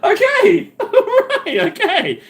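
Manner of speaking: high, helpless laughing acknowledgement. Real, not acted. Streamer cracking up at guest's obscenities, laughing. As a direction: get the words out through high laughter.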